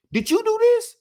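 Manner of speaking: squeaky high, astonished question; mock surprised warden. Warden asks the inmate about the portrait, in high surprise. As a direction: jump way up in disbelief.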